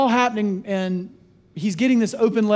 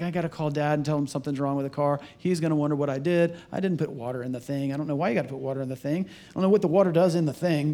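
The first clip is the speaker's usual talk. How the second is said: put-on quick, lower, softer panicky kid rambling; nervous teen driver; 6 semitones lower, 5 dB quieter, faster than usual. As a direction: rattle it off like a worried sixteen-year-old. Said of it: "They're like".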